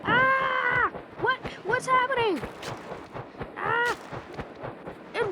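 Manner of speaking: high screaming and shouted confusion; hammed-up crash panic; mock panic. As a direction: scream and cry out as if going down. Acted crash in flight.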